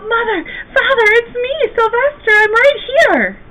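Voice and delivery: high, loud calls to his parents; desperate shout. A narrator's reading. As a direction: call out with all your might. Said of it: "Oh, he wanted to shout".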